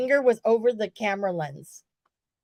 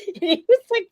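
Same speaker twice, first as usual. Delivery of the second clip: normal talking voice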